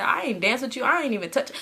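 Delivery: ordinary talk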